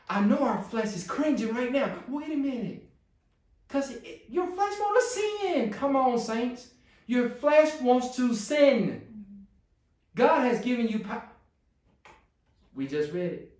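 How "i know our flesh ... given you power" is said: rising, louder preacher's call; slowing down hard on the last words; preaching. Acted. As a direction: build up to a fervent preach. Preaching builds to full force.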